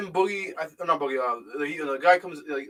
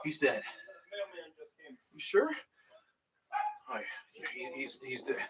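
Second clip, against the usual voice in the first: hushed quote; 2 semitones lower, 13 dB quieter than usual. Retold or imitated. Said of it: "He's like".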